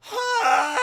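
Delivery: high loud silly roar; mock feeble roar; rubbish roar. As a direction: roar badly on purpose. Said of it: "I'm gonna do it rubbish".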